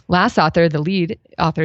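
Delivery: normal talking voice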